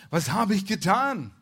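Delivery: loud, high outcry of protest; crying out. A narrator's reading. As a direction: call it out as an urgent question. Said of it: "rief er".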